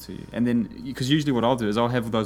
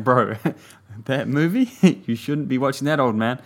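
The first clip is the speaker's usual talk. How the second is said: laughing; 3 semitones higher, 7 dB louder than usual. Retold or imitated. Mock advice, laughing through it.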